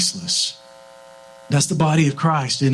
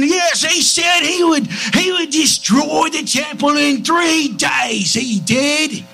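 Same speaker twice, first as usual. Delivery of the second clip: high, drawn-out Cockney jeer; Cockney bad guy; 10 semitones higher, slower than usual. Accuser taunting Jesus at the cross, high mocking Cockney. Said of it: "They like his Cockney accent".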